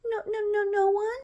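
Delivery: put-on very high, small child's denial; put-on tiny voice, nervous; scared little brother. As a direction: deny it like a caught little boy. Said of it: "like, terrified".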